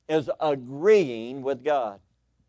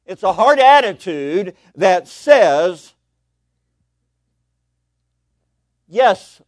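loud, high, drawn-out proclamation; big pulpit declaration; emphatic preaching; 4 semitones higher, 12 dB louder, much slower than usual. Real, not acted. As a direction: proclaim it slowly at full volume. Preacher proclaims the key point.

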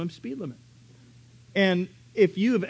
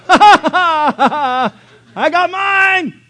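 much higher, louder cackling shout, slow at the end; laughing yell; 10 semitones higher, 14 dB louder, slower than usual. Retold or imitated. Dad yelling at speeders, laughing shout. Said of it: "And he would yell".

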